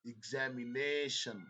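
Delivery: "Examination" slowly, weight on NA; slow stress demo. Shown as a demonstration. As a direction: stretch "Examination", hit NA. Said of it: "सुनो।".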